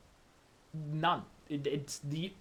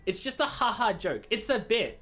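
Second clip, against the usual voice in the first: normal talking voice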